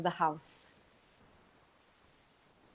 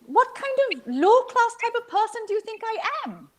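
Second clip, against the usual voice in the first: much higher, scandalised mother scolding; playfully indignant mother, much higher; mother's mock outrage; 13 semitones higher than usual. A narrator's reading. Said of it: "She would cry".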